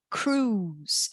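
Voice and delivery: "cruise" said kroos, no I sound; "cruise" as kroos with the I silent; said with only the U. Shown as a demonstration. Teacher to class: "cruise" said kroos, I silent. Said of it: "a gente fala um U".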